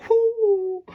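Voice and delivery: high, loud mock car whoop; car noise. Imitating a car to mock Spray getting shut down. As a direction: squeal it like a passing car. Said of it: "what does a car say?".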